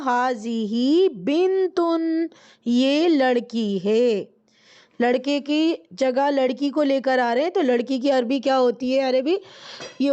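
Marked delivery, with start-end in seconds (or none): 0.0-4.2 very slow, each word stretched; slow model answer. Shown as a demonstration. Teacher models the answer for students, very slowly. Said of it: "تو جواب کیا ہوگا؟".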